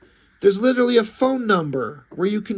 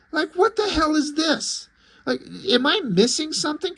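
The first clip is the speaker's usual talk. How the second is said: higher, pitched-up disbelief; incredulous; 9 semitones higher than usual. Real, not acted. Reviewer venting at the comic, high and incredulous. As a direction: voice jumping up in baffled outrage.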